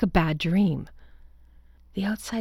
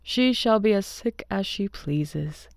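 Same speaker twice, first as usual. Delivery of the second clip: slow, fond husband voice; a lightly voiced doting husband, drawn out; husband John, fond; slower than usual. A narrator's reading. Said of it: "he said with a big hug".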